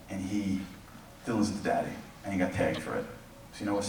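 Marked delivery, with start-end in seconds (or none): none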